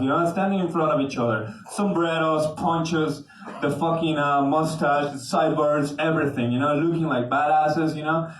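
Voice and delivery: ordinary talk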